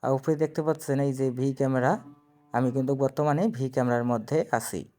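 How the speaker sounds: normal talking voice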